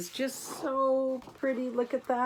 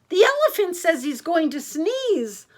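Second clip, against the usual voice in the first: birds warning; 6 semitones higher, 5 dB louder than usual. A narrator's reading. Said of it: "cried the birds".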